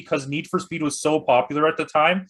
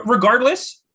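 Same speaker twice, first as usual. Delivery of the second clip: louder and higher, cutting in; a sudden louder regardless; loud interjection; 8 semitones higher, 6 dB louder than usual. Real, not acted. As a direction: raise the voice to cut him off. Cutting in over the other.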